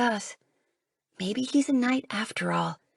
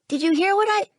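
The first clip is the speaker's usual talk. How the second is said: much higher, louder call that breaks off; calling out, cut off; 12 semitones higher, 6 dB louder, faster than usual. Acted. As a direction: call again, then get interrupted.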